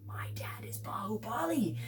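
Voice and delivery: fast, whispered revelation; excited whisper. Retold or imitated. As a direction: whisper the discovery in a rush. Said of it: "And Shiva's like".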